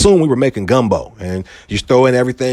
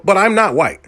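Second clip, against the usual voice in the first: higher-pitched mock denial; Pete Davidson imitation; 6 semitones higher than usual. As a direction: imitate someone protesting, pitched up. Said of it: "but he can go".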